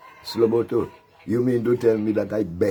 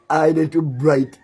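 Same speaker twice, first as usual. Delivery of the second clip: higher, louder, slow sneering brag; mock boast; 9 semitones higher, 7 dB louder, slower than usual. Jeering at the rival's brag, loud slow mockery. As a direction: stretch his boast out to ridicule it.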